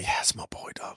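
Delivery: hushed, whispered mini-cheer; excited whisper. Retold or imitated. Celebrating a fighter's knockdown, excited whisper. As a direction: whisper it like a proud, private celebration. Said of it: "got excited".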